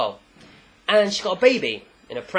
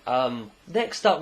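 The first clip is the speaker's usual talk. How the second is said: ordinary delivery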